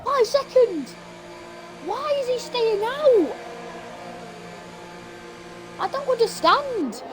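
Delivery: high, slowing disbelieving questions; baffled disbelief. Real, not acted. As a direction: sound utterly baffled. Confused reaction to penalties.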